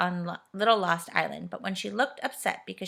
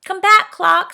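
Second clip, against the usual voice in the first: calling out; 11 semitones higher, 15 dB louder, slower than usual. A narrator's reading. Silky calls the wandering clock.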